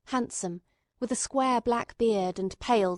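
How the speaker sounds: usual speaking voice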